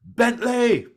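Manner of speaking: high, loud summoning yell; crying out. Acted. Old man calling for the inn owner, angry shout. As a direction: holler for the innkeeper. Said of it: "he cries out to the inn owner".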